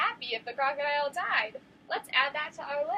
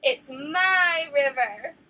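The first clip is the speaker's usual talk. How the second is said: loud, very slow menacing shout; teacher leading the repeat, loud and slow; scary shout; 2 semitones higher, 8 dB louder, much slower than usual. Shown as a demonstration. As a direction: stretch it out like a scary crocodile. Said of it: "your scariest shouting voice".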